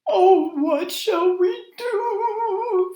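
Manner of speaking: high, slow moaning wail. A narrator's reading. Night creatures moaning as the moon is eaten, high and slow. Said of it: "the night creatures moaned".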